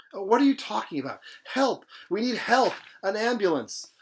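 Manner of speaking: high, loud cries for help; panicked shout for help. Acted.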